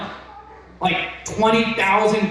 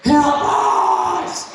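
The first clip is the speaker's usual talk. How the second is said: shouted high plea for help; desperate cry; 8 semitones higher than usual. Retold or imitated. Pleading to God, shouting high for help. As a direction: cry out to be rescued.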